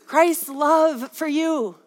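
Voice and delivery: slow, weighty preaching; slow, deliberate pulpit proclamation; emphatic declaration. Real, not acted. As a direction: draw each word out with conviction.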